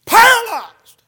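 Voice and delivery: one booming pulpit shout; sudden shout. Acted. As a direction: explode on the word, very high and loud. The one word that hits like boom.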